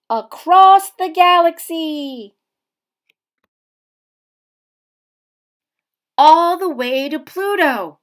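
loud, high, thrilled launch; big space-adventure cheer; excited blast-off. A narrator's reading. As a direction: shout it like a rocket taking off.

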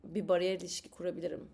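ordinary delivery

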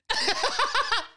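high-pitched burst of laughter; a real, helpless giggle. Real, not acted. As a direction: crack up at the absurdity. Bursting into laughter mid-rant.

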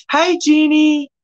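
high, bright hello; light up as a friend arrives; happy hello to a buddy; excited greeting. Real, not acted.

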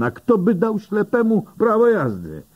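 wysoki, oburzony okrzyk pytający. A narrator's reading. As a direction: oburz się na absurd, dużo wyżej. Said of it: "oburza się Den". (Dan z oburzeniem odpowiada policjantowi)

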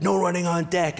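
high, loud bark across the pool; lifeguard yell. Retold or imitated. Lifeguard yells at the running boy, high and loud. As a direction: shout the pool rule. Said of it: "the lifeguard".

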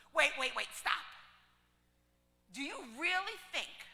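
loud, angry objection; mad. Retold or imitated. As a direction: cut him off, furious. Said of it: "she's so mad".

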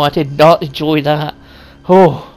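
ordinary talk, nothing marked

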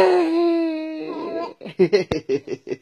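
put-on very high, long childish cackle; mischievous giggle. Son laughing at his exasperated father, high giggle. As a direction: squeal with laughter like a cheeky kid.

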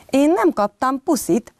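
mildly voiced pouting cub; sulky bear cub. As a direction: complain like a hurt little child. Cub complains at bedtime.

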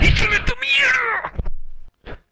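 very high, drawn-out yell; shouting. Real, not acted. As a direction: scream it at the screen. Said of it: "Pero que no grites".

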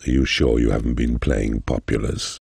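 normal talking voice

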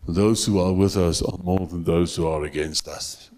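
a hint of sneering servant; mocking the prophet. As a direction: scoff at it as a silly claim. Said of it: "he makes this pathetic, ridiculous statement".

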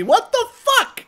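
high, loud, drawn-out disbelief; astonished outburst. Real, not acted. Player seeing an avatar like himself, astonished yell. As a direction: cry it out slowly, incredulous. Baffled by avatar resembling him.

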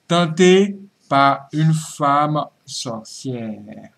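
very slow, drawn-out menace; ominous slow teaser. Acted. Host teasing the witch story, slow and ominous. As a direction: let each word hang ominously.

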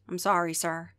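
calm, unbothered ticket monster; understated monster clerk; deadpan monster greeter. Acted. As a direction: refuse politely, unmoved. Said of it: "That should be his accent".

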